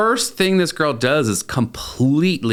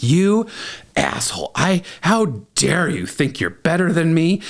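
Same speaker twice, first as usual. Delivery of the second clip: mock angry outburst; 4 semitones higher than usual. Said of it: "goes off on me".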